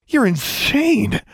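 high, drawn-out shocked gasp. A narrator's reading. Winded bully faces his attacker, gasping. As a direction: gasp it slowly in alarm. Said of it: "Larry gasped".

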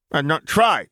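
put-on high, stern little child voice; a tiny boy scolding like a Jedi master; severe toddler. A narrator's reading. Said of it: "Ben said severely".

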